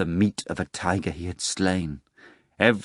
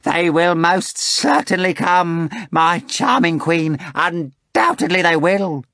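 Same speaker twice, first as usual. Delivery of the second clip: high, slow, louder ominous assurance; a jester promising trouble; Korofiov, slow and certain; 10 semitones higher, 6 dB louder, slower than usual. Acted. Korofiov voiced.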